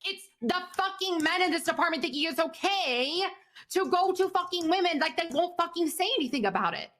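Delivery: rising angry pitch, slowed and pressed; fed up, pushing back hard at him; heated complaint to a colleague, voice climbing. Acted.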